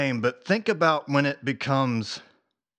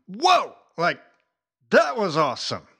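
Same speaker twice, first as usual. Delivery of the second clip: retold amazed "whoa", pitch jumping then falling; wowed spectator reaction; impressed; 3 semitones higher, 3 dB louder than usual. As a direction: re-enact being impressed, pausing between phrases. Acting out his amazed reaction.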